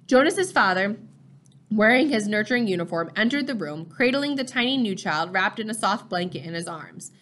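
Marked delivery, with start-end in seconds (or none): none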